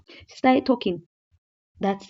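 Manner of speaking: normal talking voice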